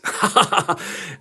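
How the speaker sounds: short breathy burst of laughter; mocking laugh. Real, not acted. Host jabbing at Daily Beast writers, mocking laugh.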